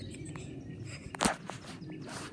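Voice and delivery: soft unvoiced giggle; stifled giggling; hushed breathy laughter. Real, not acted. Continued laughing.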